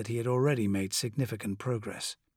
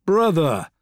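plain reading voice

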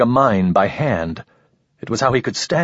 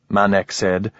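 ordinary delivery, nothing marked

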